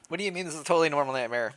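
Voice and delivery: rapid, teasing protest; fast teasing. Real, not acted. Player teasing the developer, rapid mock outrage.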